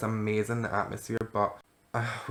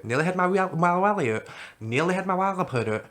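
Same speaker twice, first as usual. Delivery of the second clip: silly voice; 8 semitones higher, 4 dB louder than usual. Pretend. Silly euphemism voice.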